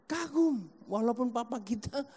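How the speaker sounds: normal talking voice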